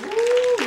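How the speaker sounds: long high-pitched "ooh"; preacher's high exclaimed "ooh". Real, not acted. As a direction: let out a high, drawn-out "ooh". Preacher reacting to congregation.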